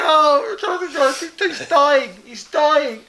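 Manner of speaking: loud, high, hammed-up hilarity; gleeful uproar. Reporting Jonathan dying at the snub, loud gleeful uproar. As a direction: crow over his embarrassment. Friend gleeful at his humiliation.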